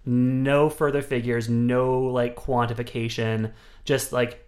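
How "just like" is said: normal talking voice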